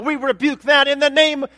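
loud, high Southern rebuke; Southern accent. Acted. Preacher mimics believers rebuking the devil, Southern accent. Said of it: "sorry for the southern accent".

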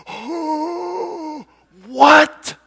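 high drawn-out "oh", then explosive "what"; hammed-up shock bursting very loud; astonished cry. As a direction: gape at a sudden miracle. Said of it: "And you go".

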